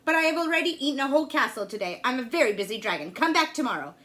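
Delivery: loud, grumbling busy dragon; loud impatient dragon voice. A narrator's reading. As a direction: boom it out like a bored, greedy dragon. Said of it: "The dragon stuck his nose out of the door and said".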